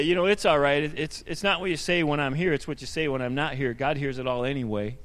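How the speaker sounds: low, understated sarcastic aside; retold dry sarcasm, pitched well down; light sarcasm. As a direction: needle them lightly, voice dropped low. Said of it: "a little sarcasm in there".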